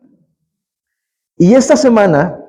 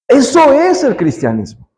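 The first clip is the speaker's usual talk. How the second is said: raised, insistent pulpit voice; full-force preaching; 4 semitones higher than usual. Acted. Preacher proclaiming to the congregation, fervent preaching. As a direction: drive the point home to the church.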